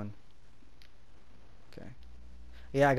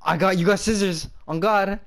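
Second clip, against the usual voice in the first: excited; 7 semitones higher, 4 dB louder than usual. Real, not acted. Lying about the result, excited.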